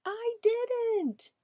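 put-on high, slow, whiny little kid protesting; upset child voice. Child defending herself after knocking over a tower, whining high. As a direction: wail it like a child whose tower fell.

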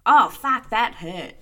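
loud pained yelp on oh fuck; mock hurt cry at a spiky rose; pretend ouch. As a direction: yelp as if pricked by a rose thorn. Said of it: "you'd be like".

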